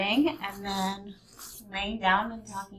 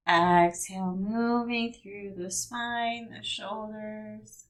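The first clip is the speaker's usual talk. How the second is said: calm yoga instructor talking through movements; guided meditation; 3 semitones higher, 4 dB louder than usual. Acted. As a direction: guide the body through each stretch calmly.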